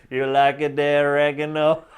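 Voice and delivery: slow, lightly voiced Italian accent; a lighter echo of the pizza boss; imitating boss's accent. Repeating the boss's line in a light Italian accent.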